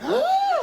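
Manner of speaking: very high, loud theatrical gasp; horrified gasp. Acted. Hearing footsteps behind him, hammed-up horrified gasp. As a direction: gasp in exaggerated terror.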